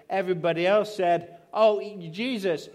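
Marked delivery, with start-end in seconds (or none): 1.5-2.7 higher, louder, slow exasperated protest; mock crowd objection. Bystanders correcting Jesus, a mock exasperated protest. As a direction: play the crowd scolding Jesus as if he missed it.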